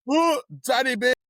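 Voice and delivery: high, loud whoop for the name; a teasing fan whoop on cue; mock cheer. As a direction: cheer her name instead of trashing her. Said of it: "we're gonna say how much we hate Danny Beard".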